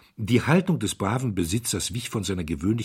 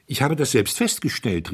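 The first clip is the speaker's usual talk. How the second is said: put-on raised, eager young voice; called out; 6 semitones higher, 4 dB louder than usual. A narrator's reading. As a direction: call it out keenly. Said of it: "rief der junge Herzog". (eifrig gerufen, jugendlich)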